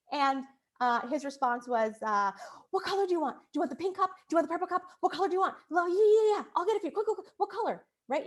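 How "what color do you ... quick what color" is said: higher, rushed eager-to-please questions; anxious dad. Retold or imitated. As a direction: fluster like a dad desperate to stop the screaming. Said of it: "so anxiety provoking for him".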